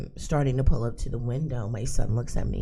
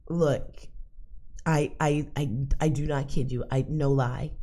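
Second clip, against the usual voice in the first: normal talking voice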